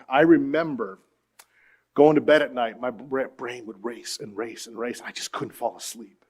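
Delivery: ordinary talk, nothing marked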